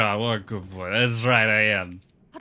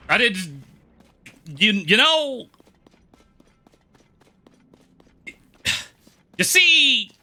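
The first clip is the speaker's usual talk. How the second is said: squeaky put-on voice; 12 semitones higher, 7 dB louder, slower than usual. High far put-on voice.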